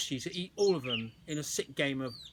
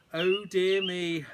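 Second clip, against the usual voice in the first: slow, louder amused sigh; giggling to himself; 5 semitones higher, 6 dB louder, much slower than usual. A narrator's reading. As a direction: savour the funny image. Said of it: "he giggled to himself".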